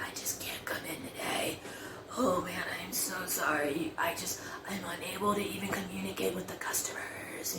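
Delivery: weak, whispery fake-sick voice; faking sick. Calling in to work pretending to be sick, feeble whisper. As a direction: croak it out softly, barely any voice. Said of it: "I actually pretended to be sick".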